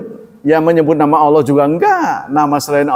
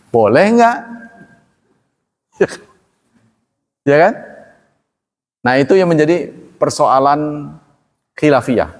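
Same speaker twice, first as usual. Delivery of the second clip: normal talking voice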